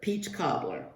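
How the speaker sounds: usual speaking voice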